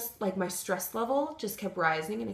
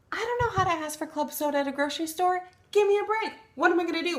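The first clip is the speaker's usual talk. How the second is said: frustrated rant; 11 semitones higher, faster than usual. Real, not acted. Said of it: "and I'm frustrated, and I'm mad".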